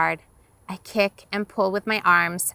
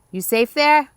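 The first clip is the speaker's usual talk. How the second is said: higher, louder question called across; worried shout; 7 semitones higher, 7 dB louder than usual. Acted. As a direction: call out, checking she's safe. Dad shouting across river.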